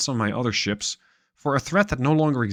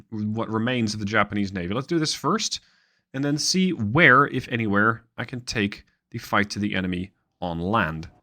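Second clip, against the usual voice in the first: ordinary delivery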